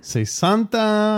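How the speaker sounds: Sesanta, slow and drawn out, high pitch; slow Italian word. Shown as a demonstration. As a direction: say Sesanta slowly, syllable by syllable. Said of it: "learner repeats slowly".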